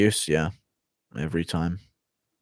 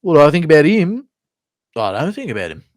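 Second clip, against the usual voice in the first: Whitaker impression; 6 semitones higher, 6 dB louder than usual. Whitaker asked about a rival, weird swooping voice. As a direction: pipe up with the question, then swoop through the answer. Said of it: "That's a bit more Whitaker".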